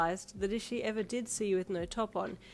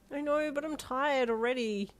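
higher, whining groan; 7 semitones higher than usual. A narrator's reading. Parent flopping onto the table, tired groan. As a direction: groan it out wearily. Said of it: "you groaned".

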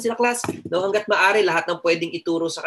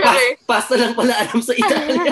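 laughing through the words, higher and louder; 8 semitones higher, 7 dB louder than usual. Real, not acted. Teacher laughs at her own joke to the class, laughing. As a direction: crack up while confessing the joke.

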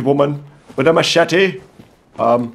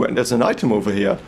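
normal talking voice

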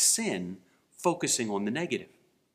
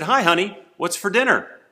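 raised voice, calling from across the kitchen; 6 semitones higher, 5 dB louder than usual. Retold or imitated. Husband calling to his wife from a distance, louder. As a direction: call out brightly to the wife. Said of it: "And he said".

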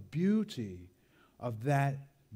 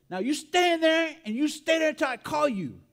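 stern scolding aunt; 13 semitones higher, 7 dB louder than usual. Retold or imitated. Aunt tells punished grandson to stay, stern orders. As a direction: imitate her loud, high-pitched commands. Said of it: "and she says".

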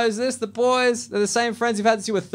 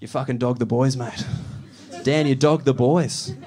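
mock mates; 6 semitones lower than usual. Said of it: "be like".

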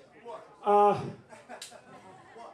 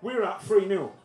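ordinary talk, nothing marked

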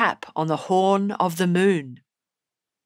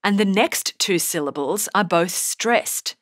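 normal talking voice

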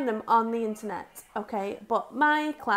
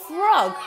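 frog, higher and louder, like a reveal; frog announced; 6 semitones higher, 10 dB louder than usual. Shown as a demonstration. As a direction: unveil frog with a flourish. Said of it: "the next word we're going to write".

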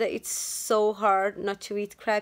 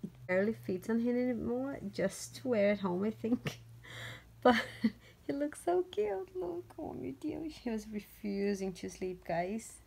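hushed; 9 dB quieter than usual. Real, not acted. Baby napping, she talks low.

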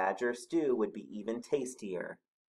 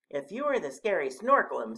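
higher, louder small animal voice; Rabbit's voice; 7 semitones higher, 5 dB louder than usual. A narrator's reading. Rabbit questioning the monster, higher character voice. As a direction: challenge the monster lightly from below. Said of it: "said Rabbit".

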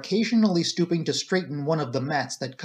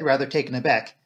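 usual speaking voice

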